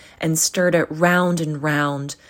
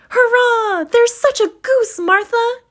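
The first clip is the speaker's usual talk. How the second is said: put-on very high, loud cheering kids; high, loud children's hurrah; excited children; 7 dB louder than usual. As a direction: cheer like thrilled little children. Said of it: "cried the two young Cratchits".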